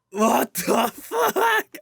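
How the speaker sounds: slow, loud disbelief breaking into a laugh; laughing mock shock. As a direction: stretch it out and crack up midway.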